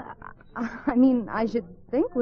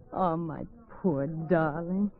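tender; 6 semitones lower, 8 dB quieter, much slower than usual. Acted. Lovers reconciling, tender.

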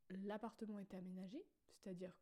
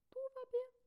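squeaky high; 4 dB louder than usual. Pretend. Playful singsong reassurance.

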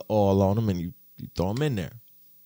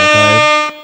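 loud rising hype shout; big loud call to hype it up; shout-out yell; 16 dB louder than usual. Real, not acted. Vlogger shouting out his new air fryer, loud hype yell.

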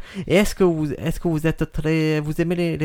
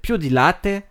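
fake Italian accent; 3 semitones higher, slower than usual. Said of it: "tu parles très bien le faux italien".

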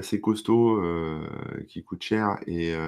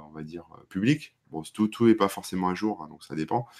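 usual speaking voice

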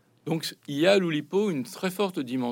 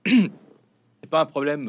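slow, strained breaking voice; words catch in a tight throat; voice cracking; 3 semitones higher, 7 dB louder, much slower than usual. Real, not acted. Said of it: "ma voix se brise".

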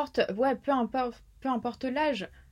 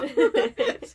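laughing; 4 semitones higher, 7 dB louder than usual. Real, not acted. Laughs at own accent.

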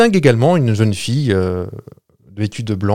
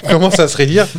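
louder retake of "Commence à se raidir", higher pitch; 6 semitones higher, 4 dB louder than usual. Shown as a demonstration. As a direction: say "Commence à se raidir" again with more volume. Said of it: "Plus fort".

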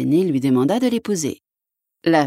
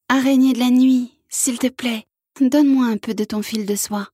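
asking princess; 7 semitones higher than usual. Acted. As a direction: call politely to the spider. Princess asks spider.

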